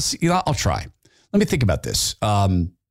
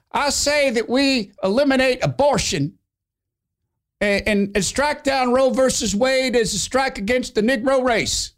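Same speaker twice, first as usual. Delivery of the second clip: much higher, eager voice from the back row; eager member; 13 semitones higher than usual. Acted. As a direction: pipe up high like a keen lackey. Floyd speaks up from back.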